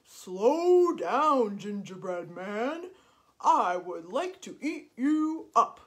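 loud, drawn-out mooing voice; cow voice. Acted. Hungry cow calling after the gingerbread man, mooing. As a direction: moo the words like a big cow. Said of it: "the cow said".

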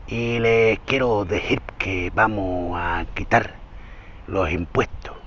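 slow, low, soft impression with spitty lisp; Andrés Manuel López Obrador. Shown as a demonstration. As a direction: drag it out quietly, lisping as if spitting. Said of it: "Como que escupe, ¿sí lo oyes?". (imitación lenta y baja, ceceando como que escupe)

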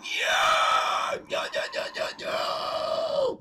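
loud shrieked yeah-yeahs; dragon screech. Pretend. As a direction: screech approval like a wild creature. Dragon roars approval.

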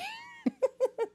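breathy squeaky laugh under the breath; stifled high giggle; high whispered giggle. Real, not acted. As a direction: giggle almost silently, very high. Giggle fit.

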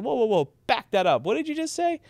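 retelling a shocked reaction to Greg; surprised. Retold or imitated. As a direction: stop a friend mid-sentence in disbelief. Hearing something shocking from Greg.

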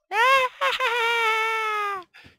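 high, loud screaming cackle; long crazed shrieking laugh; screaming maniac laugh. Acted. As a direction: shriek with insane glee. Villain laughter.

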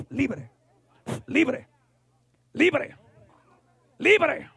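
building command. Real, not acted. Preacher commanding a woman free, building in force. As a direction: start firm, build to a high, forceful cry.

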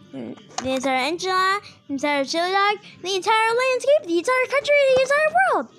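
overacted anime dub. Escalating grandiose race stakes.

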